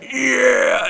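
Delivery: high, drawn-out hollering yeah; mock whooping cheer. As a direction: whoop like a rowdy flag-waver. Acting out flag-flying Southerners.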